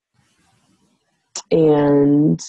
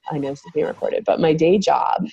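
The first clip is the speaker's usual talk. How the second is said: usual speaking voice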